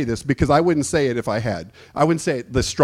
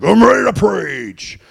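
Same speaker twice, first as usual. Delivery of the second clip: slow, high, loud declaration of readiness; eager shout; 5 semitones higher, 5 dB louder, slower than usual. Retold or imitated. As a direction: announce it like a fired-up young preacher. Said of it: "I came out here like".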